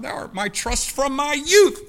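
loud, high cry on youth; rising pulpit shout; preaching shout. Real, not acted. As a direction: sing it out in fervour. Preacher quoting psalm, fired up.

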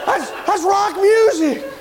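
high, loud, slow alarmed report; panicked tattling. Retold or imitated. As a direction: gasp it out as a scandal. Panicked roommate to supervisor.

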